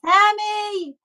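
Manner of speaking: put-on high, drawn-out child's call; yelling child. Little girl calls to Sammy in the distance, high yell. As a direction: call out to a friend down the path. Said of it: "one of them yells out".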